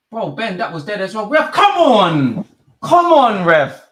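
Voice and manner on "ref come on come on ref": loud, high, drawn-out protest; angry shout at ref. Real, not acted. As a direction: bellow it at the referee. Fan shouting at referee.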